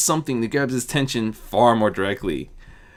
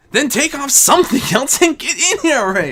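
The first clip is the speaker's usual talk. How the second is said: calling out; 14 semitones higher, 4 dB louder than usual. Acted. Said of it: "Azura called out".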